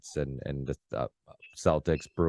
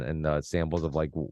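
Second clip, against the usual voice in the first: ordinary delivery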